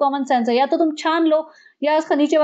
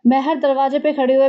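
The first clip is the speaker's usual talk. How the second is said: ordinary talk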